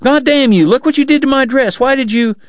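high-pitched indignant scolding; outraged cry. A narrator's reading. Kate to Doc about her soiled dress, crying out angrily. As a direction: berate him in a high voice, cut off. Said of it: "she cried".

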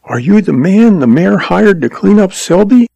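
put-on hopeful girl seeking confirmation; hopeful young woman. A narrator's reading. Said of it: "seeking confirmation of some hope".

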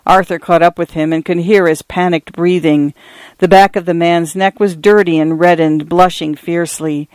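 ordinary delivery, nothing marked

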